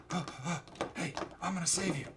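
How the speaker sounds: high, quick, soft Southern voice; mock Southern accent, hushed; Southern boy. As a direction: play a Southern medic crawling over. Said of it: "he's, like, a Southern boy".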